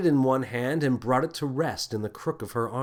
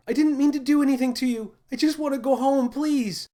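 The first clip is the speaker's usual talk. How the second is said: high, fast, desperate plea; a girl begging to go home, high and fast; pleading cry; 14 semitones higher, 4 dB louder than usual. A narrator's reading. Girl begs the captain to let her go home, high pleading cry. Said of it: "Lily cried".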